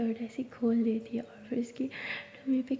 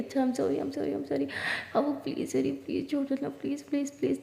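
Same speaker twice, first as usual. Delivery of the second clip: soft, breathless begging, softer; breathless pleading; 2 semitones higher, 4 dB quieter than usual. A narrator's reading. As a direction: plead for mercy between gasps. Begging while being teased. (हाँफते हुए धीमी गिड़गिड़ाहट)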